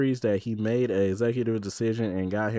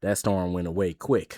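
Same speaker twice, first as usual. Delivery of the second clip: ordinary talk, nothing marked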